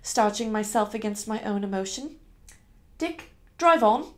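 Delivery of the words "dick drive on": higher, louder, slow command; giving an order. Acted. Young lady ordering her driver onward, raised commanding voice. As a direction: call it out firmly to the driver.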